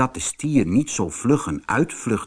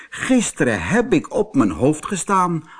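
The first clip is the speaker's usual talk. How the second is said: boastful bull; 6 semitones higher than usual. Acted. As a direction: brush it off like a braggart. Bull makes excuses.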